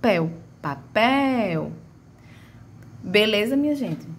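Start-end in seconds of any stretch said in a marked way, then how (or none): 0.6-1.7 "Papel" with long stressed "pel"; "Papel" leaning on a held "pel"; stretched final syllable. Shown as a demonstration. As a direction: draw out the last syllable of "Papel". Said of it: "na palavra papel é o pel".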